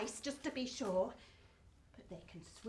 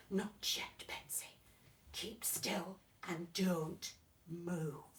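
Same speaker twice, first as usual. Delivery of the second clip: lower, slow hushed dad; father's whisper; 9 semitones lower, 5 dB quieter, slower than usual. Acted. Father telling Betsy to keep still, low and hushed.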